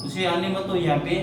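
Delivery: usual speaking voice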